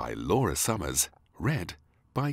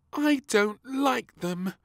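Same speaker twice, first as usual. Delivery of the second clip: put-on high, slow child pout; sulky little princess; 6 dB louder, slower than usual. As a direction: refuse the food with a pout. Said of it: "said little Princess with a frown".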